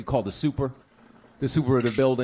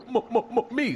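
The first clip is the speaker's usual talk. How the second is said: high heckler's stammer, imitated; mock stutter; 9 semitones higher than usual. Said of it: "I hear this voice in the back".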